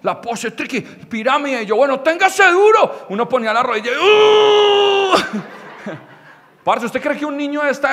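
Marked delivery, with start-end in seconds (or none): 1.8-3.1 called-out warning. Acted. Acted call in the human pyramid. 4.0-5.1 long, high strained "uuh"; pained wail, far above speaking pitch; strained cry. Acted. Acted strain kneeling in the pyramid.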